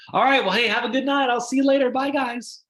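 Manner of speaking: a lightly bright, high send-off; cheerful goodbye demo. Shown as a demonstration. Host demoing the dinner's closing goodbye, bright. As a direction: wave off the guests warmly. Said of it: "that's literally our ending".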